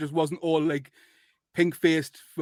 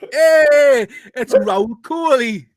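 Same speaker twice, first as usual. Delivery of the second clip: loud, high, drawn-out reveal of the guest; big guest announcement; 7 semitones higher, 9 dB louder, much slower than usual. Acted. Host unveils guest to listeners, loud slow announcement.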